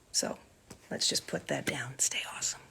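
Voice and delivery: whispered while writing; whispering. Real, not acted. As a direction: whisper the words as you write. Writing an autograph message.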